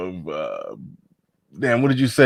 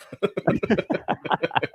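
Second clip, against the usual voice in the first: breathy laugh; 7 semitones higher, 7 dB quieter than usual. Real, not acted. Laughing at his own yawn.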